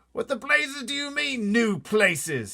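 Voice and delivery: high, loud, slow outraged bellow; blustering officer exploding; shouting Air Force chief. Acted. Air Force head shouting at the pilot, outraged. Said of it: "shouted the head of the Air Force".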